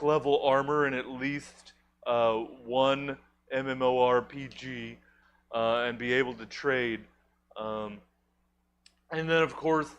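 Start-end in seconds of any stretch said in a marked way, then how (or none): none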